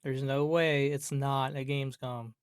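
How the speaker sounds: normal talking voice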